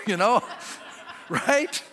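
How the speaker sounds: usual speaking voice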